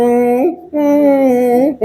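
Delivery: put-on high idle humming like a bored kid; mock child humming. Teacher imitating children humming to themselves, high pitched. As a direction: hum absently, rising each time. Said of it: "बच्चे ऐसे ही बैठे बैठे".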